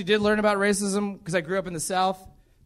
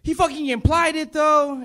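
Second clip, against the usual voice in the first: imitating mom; 7 semitones higher than usual. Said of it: "She said".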